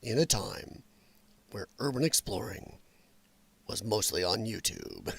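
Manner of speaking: very low, measured movie-trailer voice; deep announcer voice, breaking into a laugh at the end. Shown as a demonstration. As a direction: drop way down low and intone it. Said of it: "I don't think I can even do my deep voice".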